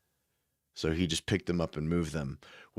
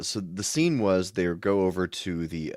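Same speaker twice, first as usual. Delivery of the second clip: ordinary talk, nothing marked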